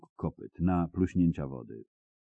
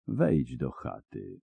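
lower, slow, gentle and soft; 6 semitones lower, 5 dB quieter, slower than usual. A narrator's reading. Ciri gently sending the old man indoors, soft and lower. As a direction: coax him inside tenderly. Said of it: "powiedziała Ciri łagodnie i miękko".

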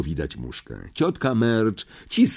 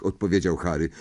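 ordinary delivery, nothing marked

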